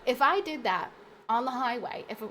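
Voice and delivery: usual speaking voice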